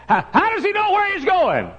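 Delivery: high, loud mock shriek; panicked old lady. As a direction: holler in comic alarm at your seatmate. Old lady demanding an answer.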